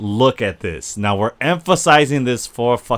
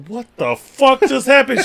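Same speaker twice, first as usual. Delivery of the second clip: much higher, stunned mock outcry; shocked disbelief; 14 semitones higher than usual. Riri reacting to Natalie's touch, shocked and high. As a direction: act out Riri's jaw-dropping shock. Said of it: "Riri's face was like".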